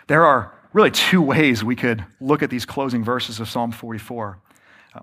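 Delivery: normal talking voice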